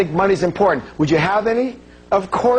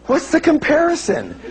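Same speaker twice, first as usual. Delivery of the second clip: high-pitched, exasperated disbelief; comic incredulity; 12 semitones higher than usual. Real, not acted. Speaker answering those who rank love over money, comically incredulous. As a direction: pitch it way up, baffled by the argument.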